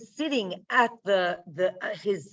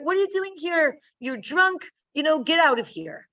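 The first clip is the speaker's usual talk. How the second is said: scolding priest; 6 semitones higher, faster than usual. Retold or imitated. Priest Eli rebuking Hannah he thinks is drunk, mock scolding. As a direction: shoo her out like a drunk.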